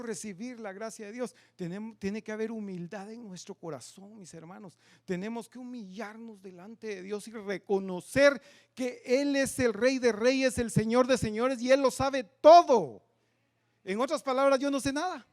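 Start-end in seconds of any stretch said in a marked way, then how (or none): 5.0-15.3 loud, rising proclamation of God's majesty; proclaiming the King of Kings; preaching at full force. Acted. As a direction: build to a ringing climax. Climax of sermon.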